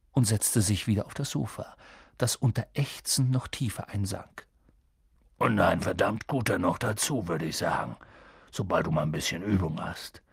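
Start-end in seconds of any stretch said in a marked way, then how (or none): 5.4-10.2 low, husky giant voice; gruff kindly giant. A narrator's reading. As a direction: rumble it out, low and easy.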